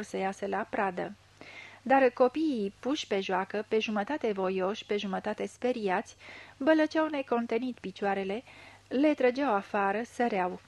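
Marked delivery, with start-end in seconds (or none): none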